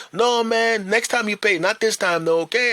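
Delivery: high, firm friendly refusal; insistent shop owner. Retold or imitated. Owner refusing to charge him, high and insistent. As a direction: wave off the payment.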